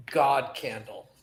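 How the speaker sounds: ordinary delivery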